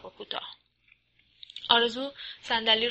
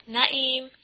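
mildly raised summoning call; 4 semitones higher, 4 dB louder, slower than usual. A narrator's reading. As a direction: call toward the door, a little higher. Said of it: "رو به در صدا زد".

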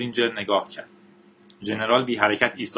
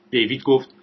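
plain reading voice

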